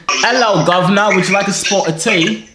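hammed-up British "guv'nor" greeting; stock British accent. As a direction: do a cliché Englishman's British accent. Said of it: "keep the accent going".

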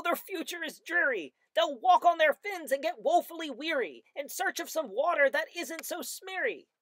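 high, loud, indignant creature voice; angry Lorax. A narrator's reading. The angry Lorax scolds the Onceler, high snapping voice. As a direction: snap at him, dander up. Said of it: "snapped the Lorax, his dander was up".

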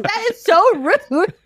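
high, slow, amused indignation; mock outrage. Teller reacting to the ugly-girl joke, mock outrage. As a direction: draw it out high, scandalized through amusement.

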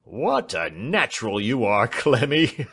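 amused, breaking into a laugh on the name; laughing heartily. Acted. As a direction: relish the joke, let the laugh burst through. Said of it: "laughed till the tears ran".

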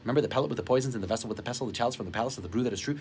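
fast tongue-twister patter; rapid recitation. Retold or imitated. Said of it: "[name] would have been able to do it".

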